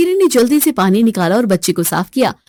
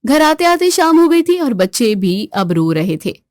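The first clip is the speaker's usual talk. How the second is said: ordinary talk, nothing marked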